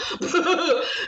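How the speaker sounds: short high laugh; amused high chuckle. Real, not acted. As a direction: burst out laughing briefly. Laughs at own joke.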